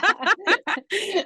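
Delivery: a bright burst of real laughter. Real, not acted. Host laughing at the guest's microwave joke, real laugh.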